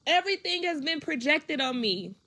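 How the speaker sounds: high, loud, emotionally charged plea; pained insistence. Real, not acted. Woman saying her younger self needed guidance, pained insistence.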